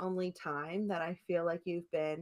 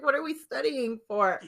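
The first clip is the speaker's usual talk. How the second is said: laughing; 4 semitones higher, 4 dB louder than usual. Retold or imitated. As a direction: giggle out the quote. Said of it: "I was like".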